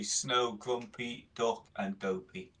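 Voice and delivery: ordinary talk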